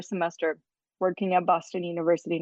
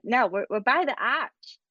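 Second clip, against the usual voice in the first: Boston "arch" with no r, a little higher; Boston accent; 5 semitones higher than usual. Retold or imitated. Parents retell strangers at commencement, mock Boston accent. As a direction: mimic a thick Boston accent on the phone. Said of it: "thick Boston accents".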